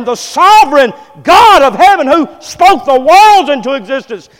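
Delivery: loud, high, impassioned sermon delivery; fiery preaching. Acted. Preacher exalting God's majesty to the congregation, full-force preaching. Preacher rising on Mary's story.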